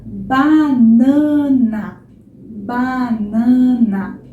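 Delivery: banana slow, first a nasal ã. Shown as a demonstration. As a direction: stretch banana with a nasal middle a. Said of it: "Repara que esse A vem antes de um N".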